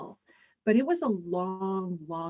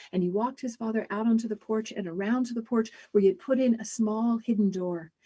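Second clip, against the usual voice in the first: normal talking voice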